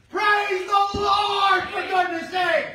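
rally the room to praise out loud; preaching at full force. Acted. Pastor urging the church to praise, shouting.

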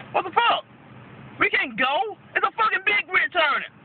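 high-pitched indignant protest; exasperated retort. Retold or imitated. Driver honked at while blocked by a big rig, exasperated. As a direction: fire back in disbelief, pitched well up. Retells outburst at honking driver.

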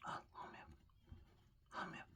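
whispered coaxing to a pet. Real, not acted. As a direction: softly coax it to bite, barely voiced.

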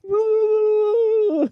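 high drawn-out retching sound effect; imitated vomiting noise. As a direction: mimic someone throwing up in one long noise. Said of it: "you hear the noise".

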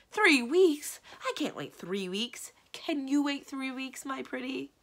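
high, drawn-out lover's outcry; ridiculously lovestruck. Acted. Lovesick magician balking at waiting for his bride, ridiculously lovestruck. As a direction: gush like a besotted suitor, slow and high. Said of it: "how absolutely ridiculous people sounded when they were in love".

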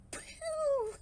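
high, drawn-out phew; relieved "phew". A narrator's reading. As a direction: let out a big storybook phew. Relief after the crash.

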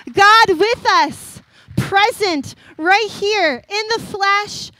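stirring sermon climax; proclaiming. Real, not acted. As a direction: preach it out, drawing each word long. Preaching climax about Emmanuel.

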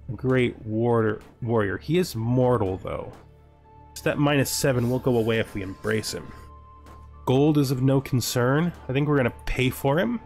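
normal talking voice

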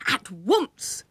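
loud, firm command; short storybook fatherly shout; father crying out. A narrator's reading. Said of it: "cried Willie's father".

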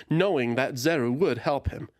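plain reading voice

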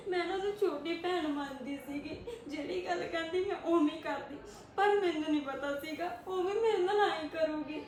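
tearful, breaking into sobs; crying. Acted. Widow telling her friend about the betrayal, sobbing. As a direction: choke the words out through tears. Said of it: "ਤੂੰ ਰੋ ਨਾ".

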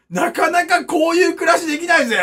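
proud shout. Acted. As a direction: shout it out as a brag. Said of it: "repeated louder". (自慢げに叫ぶ)

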